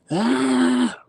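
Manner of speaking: very high, loud mock scream; imitated scream. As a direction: let out a long piercing imitation wail. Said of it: "So he stopped screaming".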